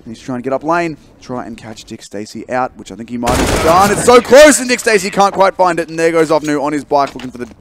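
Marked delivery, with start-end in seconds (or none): 3.9-7.5 high, loud peak that tapers off; hyped match caster; excited play-by-play. Real, not acted. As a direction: cry out the near miss, then follow on. Live esports play-by-play.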